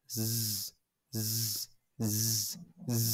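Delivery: "জ" as separate long buzzes, no vowel; Z sound drill. Shown as a demonstration. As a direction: repeat "জ" as a sustained zzz. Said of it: "জেড এর মতো যেটা আছে".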